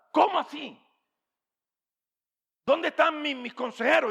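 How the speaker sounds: high, urgent king asking; a lightly voiced demanding king; king's demand. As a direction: press the servants like an impatient king.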